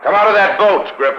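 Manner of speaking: loud commanding call across the dock. Acted. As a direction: order him out of the boat, loudly. Calling to Grippo on boat.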